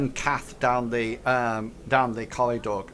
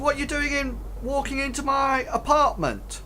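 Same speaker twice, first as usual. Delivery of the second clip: much higher demanding question; Hank voiced; 12 semitones higher than usual. GM voicing Hank confronting the intruders, high. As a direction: ask it high and indignant. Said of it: "He says".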